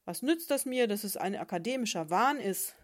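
loud, angry octopus shout. Acted. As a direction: yell it out in a rage. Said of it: "schrie Karlchen Krake".

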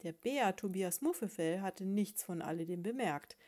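ordinary delivery, nothing marked

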